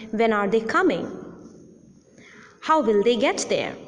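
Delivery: wh-questions with a falling end. Shown as a demonstration. Teacher showing falling wh-questions. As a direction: drop the voice on "coming". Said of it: "Also in questions beginning with a question word".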